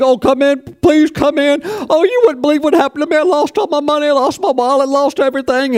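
high, fast, frantic wailing voice; high-pitched mock lament over the lost wallet; distraught neighbor. Wallet owner weeping.

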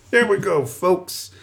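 high, soft showman voice; light, high-pitched send-up of a folksy host; Dave Filoni. Acted. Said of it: "That's my Dave Filoni impression".

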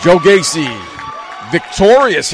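excited, loud ringside play-by-play; wrestling commentator. Acted. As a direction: call the big moves, rising hype.

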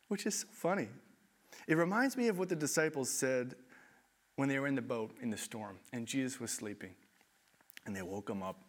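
normal talking voice

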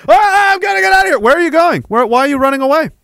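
high, loud panicked yelling; panicked fleeing official. Acted. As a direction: shout it while running away in fright. Official fleeing O'Keefe's questions.

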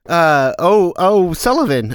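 put-on Western accent. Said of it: "hard thing to say in an accent".

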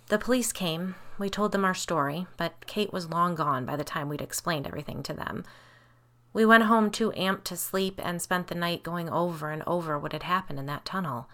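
ordinary delivery, nothing marked

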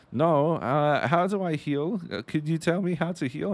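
put-on high-pitched German-accented questioning; mock German accent. German player asks new teammate for help, mock German accent. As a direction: play a clueless German newbie. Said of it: "which is why I'm doing the accent".

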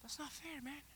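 soft, hurt complaint; left-out sulk. Retold or imitated. Andrew and the other boys sulking softly at being excluded. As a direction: mope about being left behind. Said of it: "are here like".

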